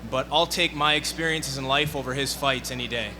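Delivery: usual speaking voice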